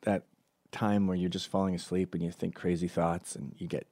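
normal talking voice

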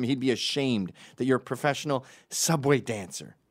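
hammed-up outrage; mock angry scolding. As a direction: tell them off like a fed-up stranger. Imagined angry telling-off of dancers.

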